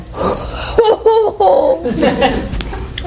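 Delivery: high hooting mock laugh; long, high put-on laugh; laughing out loud. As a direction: hoot with laughter, acting out the old wife. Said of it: "out loud".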